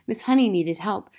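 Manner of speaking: ordinary delivery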